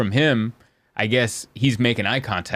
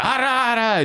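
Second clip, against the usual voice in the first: high-pitched gibberish Jamaican rant; mock Jamaican ranting; 11 semitones higher than usual. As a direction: badly imitate a Jamaican man yelling. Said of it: "Jamaican. You know, I'm not an accent guy".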